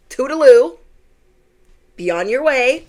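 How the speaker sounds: mocking farewell; sassy dismissal. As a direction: wave him off with sass. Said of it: "dismissing the man".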